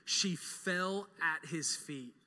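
very slow, hushed narration; slow down as she falls; slow solemn. Real, not acted. Preacher slowing to picture Mary falling at Jesus' feet, hushed.

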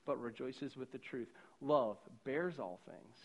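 soft, unhurried scripture reading; quiet, measured Bible reading. Acted. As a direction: read the verses gently, letting each line land. Reads 1 Corinthians 13 aloud.

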